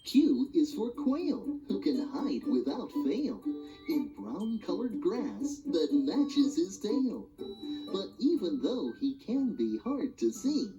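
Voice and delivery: usual speaking voice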